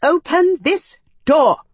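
high, loud, slow command. A narrator's reading. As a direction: order it forcefully in a high voice. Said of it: "the more female voice said".

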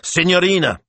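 agitated, calling out the window. A narrator's reading. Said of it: "he called, agitated".

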